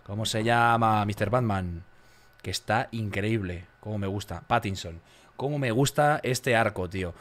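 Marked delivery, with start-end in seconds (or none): none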